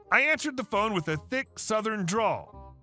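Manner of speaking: ordinary delivery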